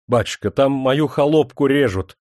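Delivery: loud, urgent cry; frantic shout. A narrator's reading. Officer begs the priest for help, frantic shout. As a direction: grab him and shout the news. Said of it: "закричал Демидов".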